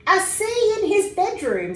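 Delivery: high, loud disbelieving echo; father echoing his son in disbelief; surprised father. A narrator's reading. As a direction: repeat it in amazement. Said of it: "said his father".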